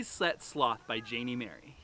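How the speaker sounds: ordinary delivery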